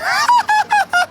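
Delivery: very high unhinged cackle; maniac laugh. Pretend. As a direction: cackle like a mad bomber. Maniac laugh after explosion.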